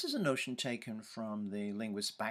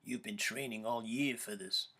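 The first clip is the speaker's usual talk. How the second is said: deep raspy voice; 3 dB quieter than usual. Retold or imitated. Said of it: "deep, raspy voice".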